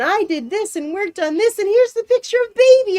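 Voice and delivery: imitating a bubbly friend rushing her news; happy and bubbly. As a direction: bounce in like the cheerful friend. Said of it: "Penn comes in all happy and bubbly".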